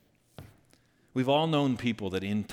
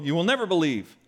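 frustrated; 5 semitones higher, 4 dB louder than usual. Acted. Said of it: "you can almost hear a little bit of frustration in his voice".

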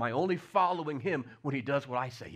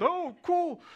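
mock surprise; 8 dB louder than usual. Imagined Christians seeing moving mountain.

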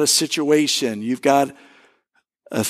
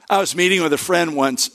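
ordinary talk, nothing marked

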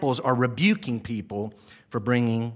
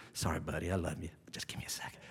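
much softer, fading into a whisper; hushed aside; 9 semitones lower, 15 dB quieter, faster than usual. Real, not acted. Preacher whispers an aside to a child, softly. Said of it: "aside to a child, hushed".

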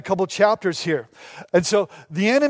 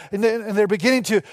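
usual speaking voice

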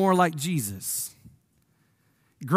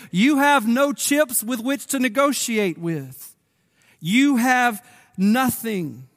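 preaching; 5 semitones higher than usual. Acted. Preacher driving home the king's terms, emphatic.

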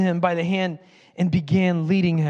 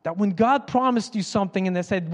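ordinary talk, nothing marked

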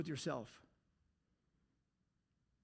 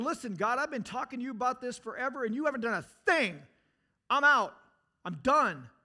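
louder, higher, fed up and walking out; angry ultimatum to God; 5 semitones higher, 9 dB louder than usual. Retold or imitated. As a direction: slam the door on God with a raised voice. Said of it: "questioning attitude, see the difference".

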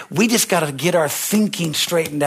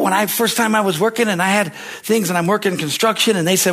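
ordinary delivery

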